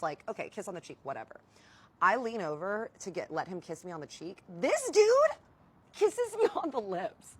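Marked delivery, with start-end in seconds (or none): 4.6-7.4 very high, loud, laughing outburst; scandalized disbelief. Retold or imitated. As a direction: drop the shocking climax at top pitch. Outraged climax of kiss story.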